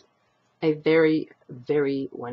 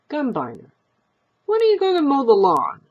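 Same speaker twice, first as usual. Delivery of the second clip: higher, louder, fast nag; fast, louder nagging wife; nagging old wife; 10 semitones higher, 7 dB louder, faster than usual. Acted. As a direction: hurry him along with a nag. Wife nags husband.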